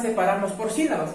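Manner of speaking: usual speaking voice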